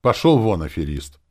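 loud at first, then dropping; contemptuous dismissal. A narrator's reading. Hero dismisses the zombie swindler, contemptuous. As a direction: send him packing with disdain. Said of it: "сказал я с презрением".

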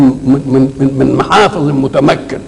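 normal talking voice

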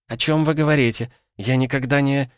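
high, bewildered woman's voice; bewildered protest. A narrator's reading. Natalya denying the affair to the countess, bewildered and high. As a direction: protest in confusion, breaking off unfinished.

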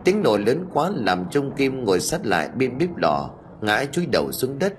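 ordinary delivery

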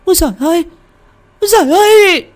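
woman screaming in panic at a ghost; terrified scream. Acted. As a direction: shriek for help in sheer terror. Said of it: "vừa kêu thét lên".

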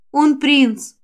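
plain reading voice

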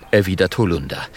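ordinary delivery, nothing marked

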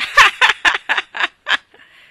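loud, high mocking guffaw; boy's roaring laughter; hearty laugh. A narrator's reading. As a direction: laugh hard at her. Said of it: "dal gran ridere".

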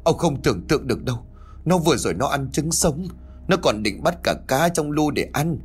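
high-pitched, agitated report; distraught wife. A narrator's reading. Wife telling husband about the raw eggs, distraught. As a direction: pour it out in acted alarm. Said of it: "rồi lắp bắp nói".

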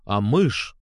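ordinary delivery, nothing marked

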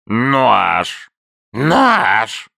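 a newt barking, lightly voiced creaky old voice. A narrator's reading. Newt barks at Abe in a mild creaky voice. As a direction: bark the word like an old creature. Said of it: "скрипучим старческим голосом пролаял".

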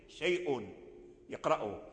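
normal talking voice

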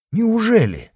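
high exclamation of surprise; shocked disbelief. A narrator's reading. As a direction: let the pitch jump in disbelief. Servant hears of the wounding.

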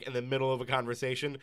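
ordinary talk, nothing marked

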